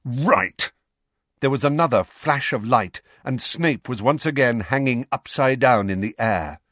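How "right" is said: snapped out, sharp and firm; furious. A narrator's reading. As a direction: let the anger break through. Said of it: "who looked furious now".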